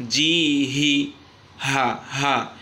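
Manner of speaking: strong open-throated breathy H sound on হা; teacher pronouncing হি and হা from an open throat. Shown as a demonstration. Teacher demonstrating the letter ha to students, open throat. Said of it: "একদম গলা খুলে".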